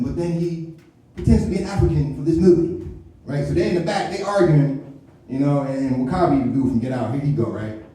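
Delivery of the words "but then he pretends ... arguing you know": ordinary talk, nothing marked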